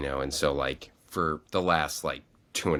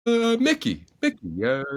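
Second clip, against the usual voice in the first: high, loud, hesitant mom voice; his mother fumbling a name in a high voice; imitating mom; 5 dB louder than usual. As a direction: mom unsure who she voted for. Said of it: "She goes".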